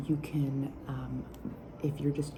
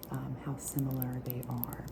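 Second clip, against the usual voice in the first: whisper with nail taps; 5 dB quieter than usual. Acted. ASMR whispered perfume review.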